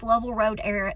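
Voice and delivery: normal talking voice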